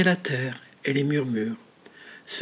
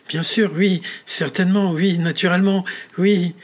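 higher, louder anxious self-reassurance; nervous reassurance; 5 semitones higher, 7 dB louder than usual. Acted. Richard anxiously convinces himself it was a slip. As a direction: talk yourself into calm, too eagerly.

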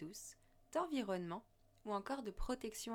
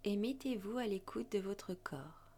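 guided meditation. Acted. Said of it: "Je vous invite à suivre ma voix lors d'une méditation".

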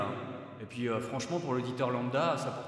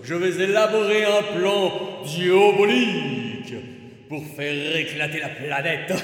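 louder, hammed-up villain; mustache twirling villain; 8 dB louder than usual. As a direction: declaim the evil plan. Villain casting audition line.

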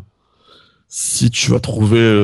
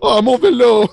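very high, loud put-on voice; high-pitched impersonation, played for laughs; comic imitation; 12 semitones higher, 5 dB louder than usual. Shown as a demonstration. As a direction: pitch it way up for a silly impression. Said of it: "une petite imitation, allez".

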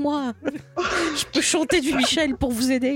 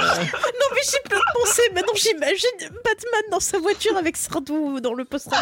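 en riant; 8 semitones higher than usual. Real, not acted. Fou rire sur [name].

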